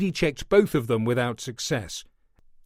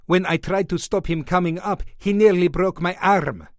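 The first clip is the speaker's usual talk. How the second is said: put-on indignant, higher, in a heavy Greek accent; 5 semitones higher than usual. A narrator's reading. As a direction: Greek doorman protests his arm, heavy Greek accent. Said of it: "spoke with a heavy Greek accent".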